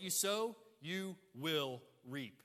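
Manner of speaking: "you will reap" very slow, each word separate; stress on the reaping. Shown as a demonstration. Preacher stating the second certainty, slow weight on "will reap". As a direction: land "will" and "reap" one at a time. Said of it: "the second emphasis and certainty".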